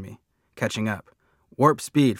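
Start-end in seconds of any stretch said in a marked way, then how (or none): 1.6-2.2 loud, high-pitched command; shouting. A narrator's reading. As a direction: shout for full speed ahead. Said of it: "Freak is shouting".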